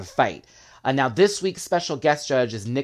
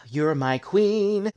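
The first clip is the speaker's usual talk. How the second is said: imitated adoration; 6 semitones higher, much slower than usual. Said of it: "you could see".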